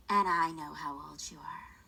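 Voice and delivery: whispered, low and menacing; ominous whisper. Retold or imitated. Niece whispering an ominous warning to her aunt. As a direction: hiss the threat softly, like a curse. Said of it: "she was like".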